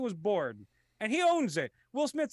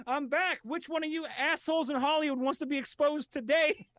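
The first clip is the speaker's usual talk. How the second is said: Ricky Gervais impression; 7 semitones higher than usual. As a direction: gloat at the crowd. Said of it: "come back and say".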